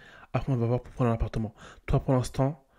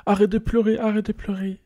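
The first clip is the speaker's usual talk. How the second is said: pleading; 8 semitones higher, 3 dB louder than usual. Acted. Soothing crying Sim.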